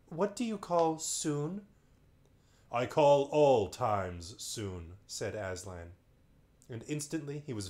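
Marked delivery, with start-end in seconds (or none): 0.1-1.7 Lucy asking. Acted. Lucy pleading with Aslan. 2.7-5.0 Aslan answering. Acted. Aslan answers Lucy grandly.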